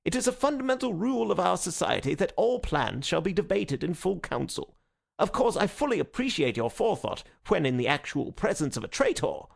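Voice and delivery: high-pitched insistence on the rules; agitated protest. A narrator's reading. Conscientious secretary lectures the President on procedure, agitated. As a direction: argue from frayed nerves.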